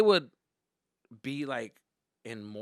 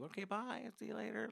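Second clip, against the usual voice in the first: high, soft parting voice; 8 semitones higher, 10 dB quieter than usual. Retold or imitated. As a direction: lightly imitate a mother leaving her kid at school.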